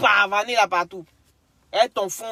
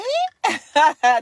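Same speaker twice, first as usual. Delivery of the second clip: loud laugh; 8 semitones higher, 7 dB louder than usual. Real, not acted. Laugh ending the mock bit.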